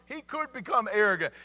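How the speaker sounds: usual speaking voice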